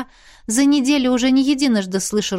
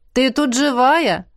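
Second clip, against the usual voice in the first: friend's voice; 5 semitones higher, slower than usual. A narrator's reading. Friend peeks into library.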